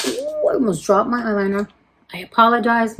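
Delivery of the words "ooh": a sudden real high-pitched "ooh"; startled high squeal. Real, not acted. Nearly drops her eyeliner mid-talk, startled high squeal. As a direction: voice jumps way up high. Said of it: "My voice went up the octave".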